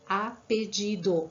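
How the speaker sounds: "Apellido" slow, with the double L sounded as a Spanish LL; teacher demonstrates "Apellido" slowly. Shown as a demonstration. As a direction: model "Apellido" carefully, lingering on the LL. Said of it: "com dois L's, doble L".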